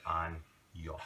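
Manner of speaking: "an yo" split slowly into "an" and "yo". Shown as a demonstration. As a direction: break "an yo" apart to show the n-y sound. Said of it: "it's like N Y".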